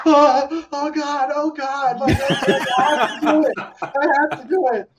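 reliving the shock of a dream job offer; overwhelmed excited quote. Retold or imitated. Retelling his reaction to Pixar's call.

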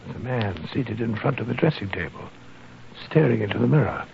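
soft, low whisper at the door; hushed voices. Acted. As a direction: keep it hushed so he won't hear. Spying through door crack.

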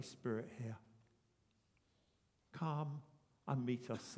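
low, soft, unhurried prayer. Acted. Pastor inviting the Holy Spirit, hushed prayer. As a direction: pray it quietly to God.

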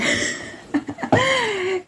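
sudden high-pitched laugh. Real, not acted. Dog interrupts the meditation.

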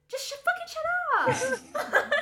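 annoyed outburst. Retold or imitated. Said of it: "they're like".

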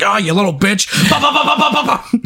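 high-pitched taunt then machine-gun noises; mock gunfire taunt. Host acting out robot shooting at Megatron. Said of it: "He's like".

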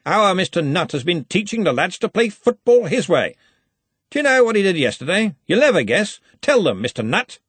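high, hearty teasing; big cheer with a slight threat; threatening joviality. A narrator's reading. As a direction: jovially put him on the spot. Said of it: "the same slightly threatening joviality".

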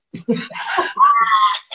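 high burst of laughter; real laugh. Real, not acted. Laughs after own joke.

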